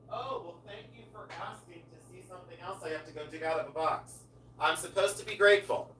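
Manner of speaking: put-on thankful host, far away; mock gratitude. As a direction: rehearse being grateful from off mic. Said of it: "instead of, like, complaining, I have to go".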